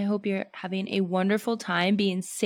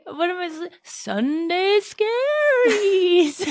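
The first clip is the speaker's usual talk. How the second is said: high, very slow wail; spooky voice; 10 semitones higher, 5 dB louder, much slower than usual. Pretend. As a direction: moan it like a ghost. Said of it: "spooky ookie voice".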